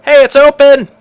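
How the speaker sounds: high-pitched shout from far back; loud, high holler; Earl. Acted. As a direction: yell it out to the group, loud and high. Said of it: "you hear Earl screaming".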